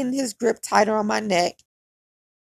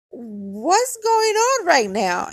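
high, drawn-out disbelief; retold slow-motion confusion; bewildered; 7 semitones higher, much slower than usual. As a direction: stretch it out, baffled and pitched up. Retold bewilderment as car stops.

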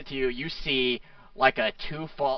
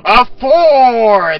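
excited announcer; 10 dB louder, much slower than usual. Acted. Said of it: "he's like".

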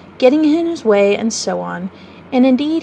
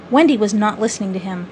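ordinary delivery

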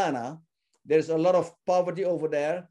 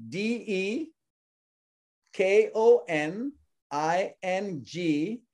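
deliberate, spaced letters with clear pauses; slow spelling; 2 semitones higher, slower than usual. Shown as a demonstration. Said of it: "say that name again slowly".

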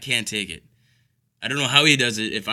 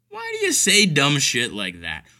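usual speaking voice

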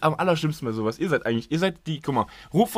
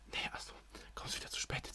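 hushed mock reproach in a whisper; whispered grumbling; 22 dB quieter than usual. Retelling everyone's reaction to him arriving late, whispered. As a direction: whisper it like friends grumbling among themselves.

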